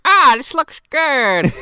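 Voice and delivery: put-on high, loud, slow caricature of an old man; mock grandfather. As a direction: draw it out high and loud in delight at cake. Acts out grandfather finding cake.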